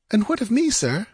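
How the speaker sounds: high, questioning heroine; woman's timid question. A narrator's reading. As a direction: ask uncertainly as the heroine. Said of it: "she asked".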